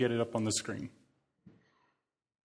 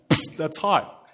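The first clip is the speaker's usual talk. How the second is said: mock child voice; 4 semitones higher, 6 dB louder, slower than usual. Mimicking son touching stove.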